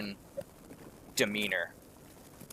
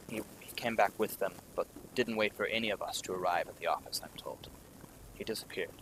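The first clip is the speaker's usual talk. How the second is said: hushed aside; 9 dB quieter, faster than usual. Acted. Ackerman confides secret suspicion.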